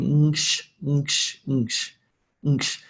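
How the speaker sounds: NKSH as one isolated blended noise; nasal NG running straight into SH; consonant cluster. Shown as a demonstration. Teacher showing a cluster to students, blended NKSH demo. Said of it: "example of a consonant cluster".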